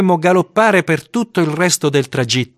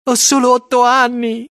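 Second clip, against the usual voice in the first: put-on much higher child's voice; frightened small boy; 12 semitones higher than usual. A narrator's reading. Eight-year-old reassuring himself in the schoolyard, high-pitched worry. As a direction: plead it to yourself in a high little voice. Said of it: "mi dicevo".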